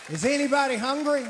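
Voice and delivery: loud, high call to the crowd; rally the room. Real, not acted. Calling out to the crowd.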